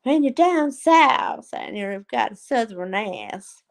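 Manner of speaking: slow, drawn-out mock southern accent. Streamer teasing about life down south, slow southern accent. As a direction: stretch the vowels in a southern drawl. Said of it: "she has such a southern accent".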